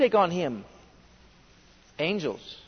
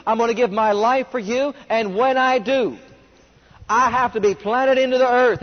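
fervent preaching; 4 semitones higher, 4 dB louder than usual. Acted. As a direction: preach it to the congregation, stretching the big words. Preacher expounding scripture with fervour.

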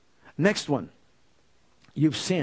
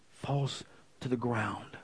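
much softer, lower and slow, almost breathed; hushed awe; 7 semitones lower, 12 dB quieter, slower than usual. Real, not acted. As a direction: let it drop to a hush. Awe at falling hair.

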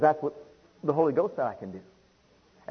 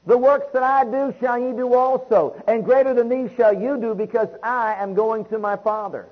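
loud, rising preacher's cadence leading the faith confessions; preaching declarations; 5 semitones higher, 5 dB louder than usual. Acted. Preacher declaring faith over the congregation, loud and fervent. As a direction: proclaim each line to the congregation like a revival call.

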